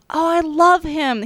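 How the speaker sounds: sudden delighted gasp; light up with recognition; excited. Real, not acted. Gasps on recognising the actor.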